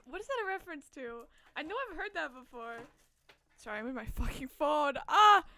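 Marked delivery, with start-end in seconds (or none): none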